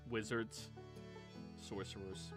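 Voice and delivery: normal talking voice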